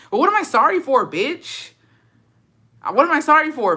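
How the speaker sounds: high, loud indignant question. Real, not acted. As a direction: demand it, offended. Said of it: "indignant rhetorical question".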